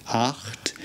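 "Acht" whispered, with a raspy ch at the back of the throat; whispered German Acht. Shown as a demonstration. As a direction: breathe the ch in "Acht". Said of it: "at the back of our throats".

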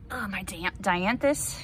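ordinary talk

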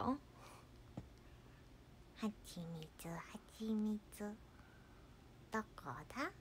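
whispery, very soft bear voice; Winnie the Pooh. Retold or imitated. Bear searching for honey, creepily whispered. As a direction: breathe it out in an eerie hush. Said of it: "こんな感じじゃないですか".

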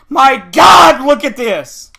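loud, frustrated outburst; exasperated shout. Real, not acted. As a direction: burst out in exasperation. Exasperated rage at the game.

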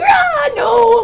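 high, wailed cry; mock anguished wail. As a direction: wail it as the devastated friend. Acting out Emily's grief.

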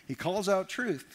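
ordinary delivery

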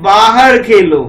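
slow, stretched verse reading; poem recitation. Shown as a demonstration. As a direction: recite the verse slowly and loudly. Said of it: "पापा क्या बोलते हैं उसके बारे में सुनते हैं".

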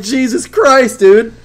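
high, loud impatient complaint; fed-up yell. Retold or imitated. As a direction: let it burst out, done waiting. Retold frustration at cutscene.

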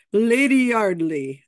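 hammed-up, slow British greeting; attempted British accent. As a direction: grandly announce the name in a shaky attempt at British. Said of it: "I can't do a British accent".

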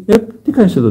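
a stern abbot's sharp question; surprised abbot. Retold or imitated. Abbot bursts into the cell, surprised and stern. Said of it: "μπαίνει ο ηγούμενος μέσα και του λέει".

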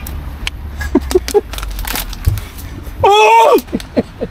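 silent wheeze of laughter; breathless wheezing laugh. Real, not acted.

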